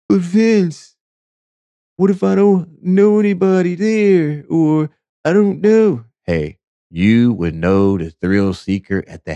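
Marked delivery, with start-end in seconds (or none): 0.1-6.0 high, put-on voice of a nervous follower; worried viewer. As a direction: play an anxious fan asking for help. Imagined viewer asks Vince worried.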